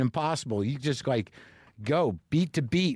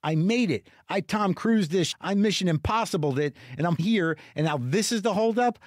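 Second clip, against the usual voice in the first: higher, incredulous exasperated rant; losing his mind; 4 semitones higher than usual. Retold or imitated. Traveler fuming at a snail's pace bag check, losing his mind. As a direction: protest the delay, worked up and disbelieving. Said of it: "I'm just losing my mind".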